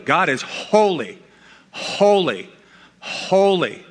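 each HOLY stressed, with a falling tune and a pause after; "holy, holy, holy" as three separate, weighty proclamations; emphatic repetition. Shown as a demonstration. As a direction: build "holy" threefold, lifting the later two. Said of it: "It's there for emphasis".

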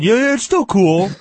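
high-pitched eager reassurance; mock insistence. Mimicking guys insisting it's cool.

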